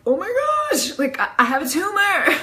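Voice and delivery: high-pitched alarmed exclamation; mock panic. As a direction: exclaim it like a dramatic hypochondriac. Retelling her own panicked reaction.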